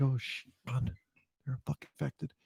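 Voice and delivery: hushed, nervous whisper; a mock panicked aside, barely voiced; scared whisper. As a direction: whisper it so the cougher won't hear. People reacting to a sneeze.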